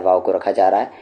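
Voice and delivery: usual speaking voice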